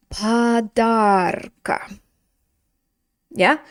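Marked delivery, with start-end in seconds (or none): 0.1-2.0 padarka stretched very slowly; very slow model word. Shown as a demonstration. Teacher models the genitive form for class, very slowly. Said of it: "That's gonna look like".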